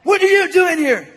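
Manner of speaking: high, loud imitation of an attacker; mock yelled demand. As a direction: yell the question out of the blue. Acts out the attacker demanding answer.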